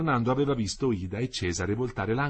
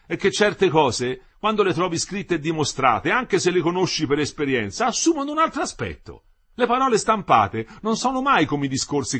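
loud, high, animated husband; Metello, enthusiastic explainer; 10 semitones higher, 7 dB louder than usual. A narrator's reading. Husband defends what books teach, enthusiastic and loud. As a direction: argue with rising enthusiasm, voice lifted.

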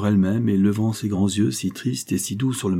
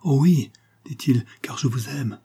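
higher, joyful outburst; higher, elated declaration; cry of joy; 5 semitones higher than usual. A narrator's reading. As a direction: burst out with joy. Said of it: "jeta un cri de joie".

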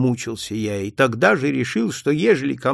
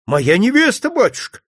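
surprised exclaim; 9 semitones higher, 4 dB louder than usual. Acted. Officer surprised at the priest's question, exclaiming. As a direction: exclaim in surprise, high and louder. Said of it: "удивлённо воскликнул".

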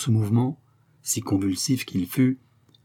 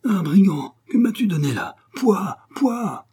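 higher, louder spluttering outcry; outraged cry; 10 semitones higher, 5 dB louder than usual. A narrator's reading. Drunk locksmith crying out after the vile drink, spluttering and higher. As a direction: spit out the disgust with a pouah. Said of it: "s'écria entre deux éternuements".

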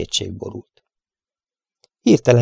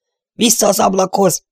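shouted order; 10 semitones higher than usual. Acted. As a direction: bark the order back to the window. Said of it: "kiáltotta Steve".

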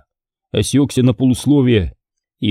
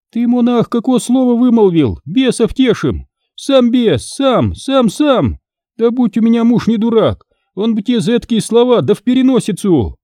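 shrill scolding wife; 12 semitones higher than usual. Acted. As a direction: rail at the monk like a furious peasant wife.

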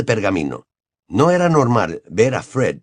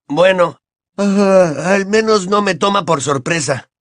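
put-on a lightly raised boyish voice; Ron's voice; 8 semitones higher than usual. A narrator's reading. Ron answers Hermione in a light boyish voice. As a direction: play the cheeky teenage boy.

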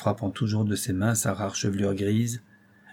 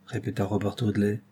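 ordinary delivery, nothing marked

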